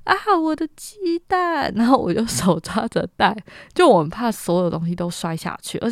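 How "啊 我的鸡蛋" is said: high, drawn-out alarmed wail; panicked cry. Retold or imitated. Slipping on the muddy slope, she wails for her egg basket. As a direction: yelp in panic for the eggs while flying. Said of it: "我飞出去的当下就说".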